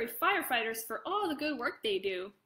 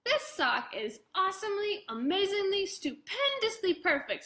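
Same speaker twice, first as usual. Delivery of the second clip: excited shout; 7 semitones higher than usual. Acted. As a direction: burst out with joy. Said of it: "Sebastian shouted".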